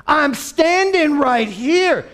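high, loud, drawn-out protest; exasperated shout. Acted. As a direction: shout slowly to be noticed. Blind man ignored by neighbours.

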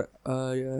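usual speaking voice

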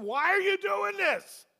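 high, loud, slow, desperate plea; anguished cry to God. Retold or imitated. A man in crisis crying out to God, anguished shout. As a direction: cry out to heaven, pleading why.

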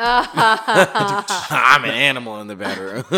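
slow, laughing mock growl. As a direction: say it through laughter. Laughing repeat of joke.

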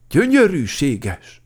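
loud, high, stretched exclamation; drawn-out, rapturous praise; admiring. A narrator's reading. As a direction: gush slowly over the beauty. Everyone admires the curly clouds.

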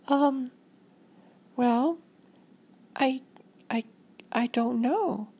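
put-on high, hesitant child voice; halting high-pitched kid; unsure little girl. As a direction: falter like a stumped small girl.

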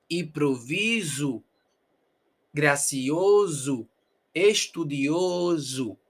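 "Improviso", "Gracioso", "Estudioso" very slow, ending -zu; Portuguese final U. Shown as a demonstration. Teacher reviewing "Improviso", "Gracioso", "Estudioso" in slow Portuguese. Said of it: "pero nosotros decimos U".

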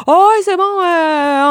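oui enjoué et spontané, plus aigu; enthousiaste. Retold or imitated. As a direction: imite un oui enthousiaste, sans hésiter. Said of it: "tu t'es tout le temps dit". (enthousiaste et plus aigu)

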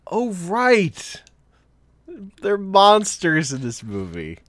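high, slow mock surprise; mock realization. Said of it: "the movie's like".

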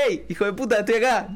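hammed-up intruder yelling at the door; pain as rude intruder. Pain personified, calling to a friend at night in a mock yell. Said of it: "va a decirte a la noche".

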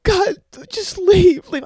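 high, loud, drawn-out order; mock distraught yell. Girlfriend orders boyfriend out, mock distraught yelling. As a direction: wail at him to get out.

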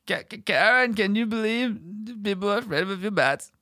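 high stammering rocker. Retold or imitated. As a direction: stutter it like a dazed old rocker. [name] voice again.

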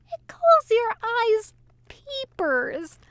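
high, slow, gleeful cackle through the words; tipsy angel teasing the demon from a book; cackling. A narrator's reading. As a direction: barely hold the words together for glee. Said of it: "he cackled".

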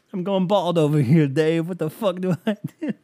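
mock despair, breaking toward laughter; exasperated caller. Stressed caller pleading with Dave, mock exasperation.

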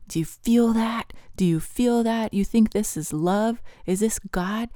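taunting enemy voice. Retold or imitated. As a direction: taunt him with each pointed question. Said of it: "the voice of the enemy saying".

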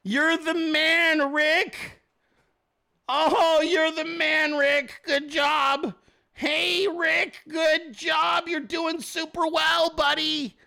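high-pitched gushing heckler; put-on fanboy encouragement, pitched high; fake-sincere cheering. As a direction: cheer him on with over-earnest praise. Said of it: "And you're sincere as fuck".

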